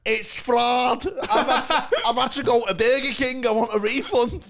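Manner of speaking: angry KFC customer demanding refund, mocked high and loud. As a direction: shriek it like the furious KFC customer. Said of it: "she went".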